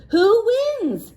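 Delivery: high, loud eager question; eager children. Acted. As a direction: ask like excited kids. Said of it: "asked the children".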